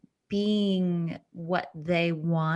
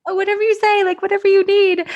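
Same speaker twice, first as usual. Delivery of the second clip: high, loud eager-to-please voice; mock meek self; 13 semitones higher, 10 dB louder, faster than usual. Actor mocks herself at audition, high and eager. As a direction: gush it, bending over backwards to agree. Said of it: "I was just like".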